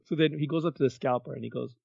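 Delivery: usual speaking voice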